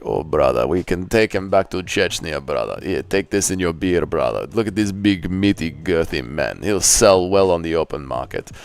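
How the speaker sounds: low, heavy put-on trafficker voice; deep impression of a dealer sizing up his goods; Armin. Trafficker selling a man to his brother, in a low role voice. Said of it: "Impression of human trafficking Armin".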